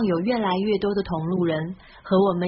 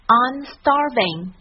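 slow, "starving" leading; slow model sentence; 3 semitones higher, 3 dB louder, much slower than usual. Shown as a demonstration. Teacher models the whole sentence once more. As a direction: read it slowly, leaning on starving. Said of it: "这个单词是这样的".

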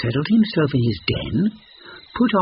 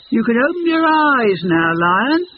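much higher, louder triumphant shout; fox shouting; 12 semitones higher, 5 dB louder than usual. A narrator's reading. Fox tricking the lion from afar, loud high shout. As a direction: call out from a safe distance. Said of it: "Fox shouted".

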